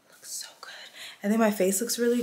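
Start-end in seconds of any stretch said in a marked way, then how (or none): 0.1-0.7 soft breathy whisper of delight. Real, not acted. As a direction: whisper it to yourself, impressed. Admiring her new wig.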